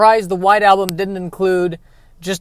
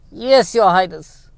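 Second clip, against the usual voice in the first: servile henchman; slower than usual. Acted. Servant answers king.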